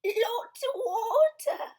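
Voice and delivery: put-on high, slow wailing baby call; shouting. Baby Blair crying out about the water. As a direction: wail it out. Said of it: "shouted Baby Blair".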